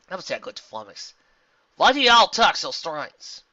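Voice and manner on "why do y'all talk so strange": put-on slow, loud country accent; Applejack's country accent. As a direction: ask in a puzzled Southern accent. Applejack questions zebra's speech.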